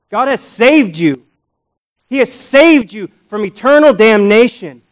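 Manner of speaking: climbing preacher's fervor; full-force preaching. Acted. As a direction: stir the congregation to awe.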